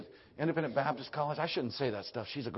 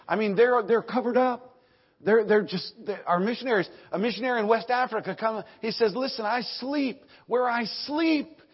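loud, high, urgent preaching; full-force preaching; 9 semitones higher, 8 dB louder than usual. Real, not acted. Preacher telling of missionary in West Africa, full-force preaching. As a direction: drive it out with a raised voice.

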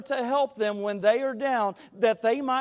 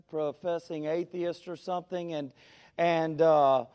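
normal talking voice

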